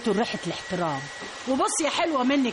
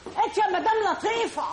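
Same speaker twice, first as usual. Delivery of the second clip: calling out; 6 semitones higher than usual. Acted. Customer calls out for Madam Latifa.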